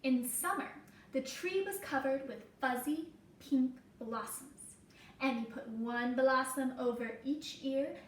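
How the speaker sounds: gentle storybook read-aloud for a class; reading to children. Acted. Reading picture book to class.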